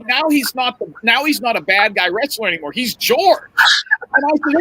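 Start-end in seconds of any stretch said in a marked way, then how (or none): none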